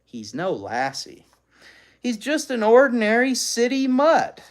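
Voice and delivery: loud, high scoffing dismissal; dismissive father. Retold or imitated. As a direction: brush the dog off with a scoff. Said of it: "That's why he says".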